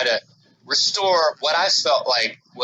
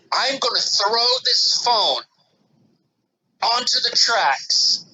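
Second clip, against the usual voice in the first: snapping; 7 semitones higher, slower than usual. Retold or imitated. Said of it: "she could see that something was wrong, snappy inside of me".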